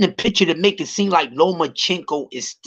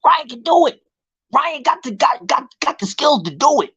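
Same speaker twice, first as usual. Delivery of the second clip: mocking hype fans; 8 semitones higher than usual. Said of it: "And everybody like".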